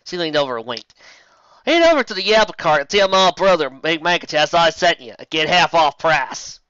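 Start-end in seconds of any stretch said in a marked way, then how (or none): 1.6-6.6 Applejack voice. Acted.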